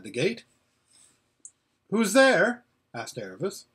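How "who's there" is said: high, raised questioning call; startled call. Acted. Aravis calls to the gate, startled. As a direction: call out sharply to whoever is outside.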